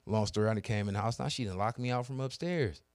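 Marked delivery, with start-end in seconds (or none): none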